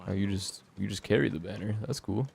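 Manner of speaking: ordinary talk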